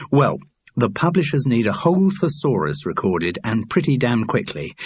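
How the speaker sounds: ordinary delivery, nothing marked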